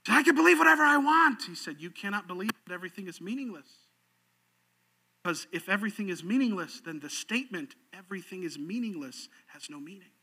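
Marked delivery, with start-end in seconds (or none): 0.2-1.4 high, loud, fast outburst; acted heated, high-pitched protest; angrier retort. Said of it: "He got even more angry". 1.8-10.1 soft, measured logic; hushed acted apologist; calm rebuttal. Apologist calmly explains his rebuttal.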